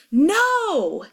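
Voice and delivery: high, long-drawn cry of alarm; drawn-out high yell. A narrator's reading. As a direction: cry out in dismay, stretching it. Alarmed as horse takes off.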